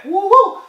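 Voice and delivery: high, loud double "woo"; admiring whoop. Real, not acted. Admiring how good she looked.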